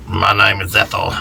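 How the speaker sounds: lightly voiced low smoker woman; truck-driver-like woman smoker. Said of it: "She sounded like a truck driver".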